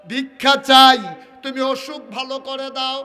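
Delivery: sudden loud, high chanted plea; impassioned begging prayer. Acted. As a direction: burst out begging God at full voice.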